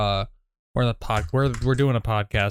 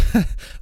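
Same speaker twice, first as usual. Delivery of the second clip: breathy nervous giggle; a quick high nervous laugh; nervous laughter; 8 semitones higher than usual. Shown as a demonstration. Son caught out in a prank, nervous giggle. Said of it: "that whole nervous laughter".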